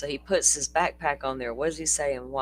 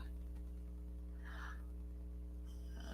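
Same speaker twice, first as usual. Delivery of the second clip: long breathy tired sigh; long weary sigh; 9 semitones higher, 16 dB quieter than usual. Real, not acted. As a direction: let out a long exhausted sigh. Tag alone, long sigh.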